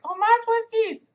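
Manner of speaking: put-on very high little-kid voice with Spanish accent; loud, high Spanish-accented kid question; kid's Spanish accent. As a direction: imitate a curious child with a Spanish accent, slow and squeaky. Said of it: "they have that Spanish accent".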